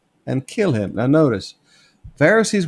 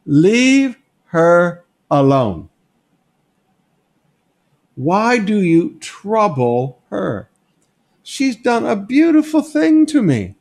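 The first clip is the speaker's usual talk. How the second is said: slow, louder, firm rebuke rising higher; a gentle but firm master defending the woman; slowed, weighty, then lifting high; Jesus rebuking; 5 semitones higher, 4 dB louder, slower than usual. Acted. Preacher voicing Jesus rebuking apostles.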